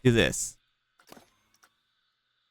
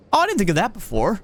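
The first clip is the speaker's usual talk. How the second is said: retold excitement; 6 semitones higher, faster than usual. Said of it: "I've been like".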